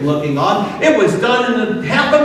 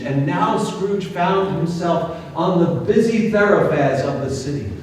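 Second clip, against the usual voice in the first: usual speaking voice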